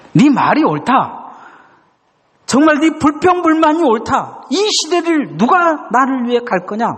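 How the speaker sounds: high, building, insistent; God's earnest reply. Retold or imitated. God answering the grumbling young man, high and urgent. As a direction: press the question upward with conviction.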